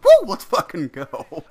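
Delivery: loud, high, drawn-out whoop; hyped cheer. Retold or imitated. Excited traveller cheering about the Mexico trip, loud whoop. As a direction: cheer like you just heard you're going to Mexico.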